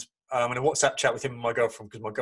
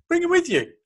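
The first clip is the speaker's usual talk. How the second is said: high; 6 dB louder than usual. Retold or imitated. Gilberto's invitation retold.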